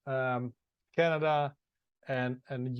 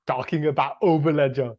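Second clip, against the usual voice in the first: put-on louder, a light Austrian accent; Arnold Schwarzenegger accent; 7 dB louder than usual. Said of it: "his accent for a bit and talk about Overledger".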